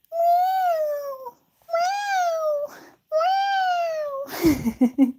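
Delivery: long, wailing mock meows; imitated baby-cry meow. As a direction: wail like a demanding cat. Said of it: "turns on his baby cry".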